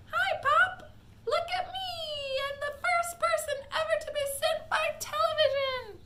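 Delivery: put-on a tiny boy showing off; tiny squeaky voice. A narrator's reading. Shrunken boy boasting to his parents in a squeak. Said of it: "A tiny little voice, no louder than the squeaking of a mouse".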